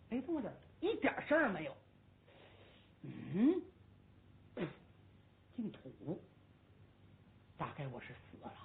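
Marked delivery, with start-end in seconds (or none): 3.0-8.5 much lower and much softer; dazed murmur. Acted. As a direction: mumble as if already dead. Hu Dahai thinks he is dead. (胡大海以为自己死了，低声喃喃)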